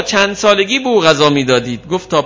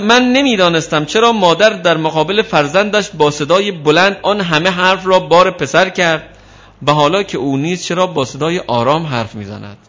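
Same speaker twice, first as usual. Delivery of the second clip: usual speaking voice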